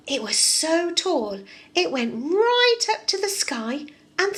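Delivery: put-on lively read-aloud for small children; storybook reading. A narrator's reading. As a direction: tell it like a bedtime story for kids. Reads fairy tale to children.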